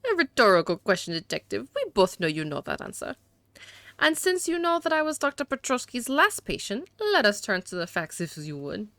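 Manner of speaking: hammed-up French accent for a haughty suspect. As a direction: play a disdainful gentleman with a badly faked French accent. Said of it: "See, my accents are awful".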